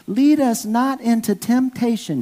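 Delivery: put-on high, careful child's voice, each word enunciated; little girl praying. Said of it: "She carefully enunciated each word".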